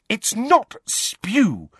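high, indignant correction, drawn out; hotly offended, stressing each word; heated. A narrator's reading. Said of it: "said Hermione heatedly".

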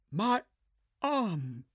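put-on high, strained young woman in pain; Zula voice. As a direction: clutch the wound and gasp it out.

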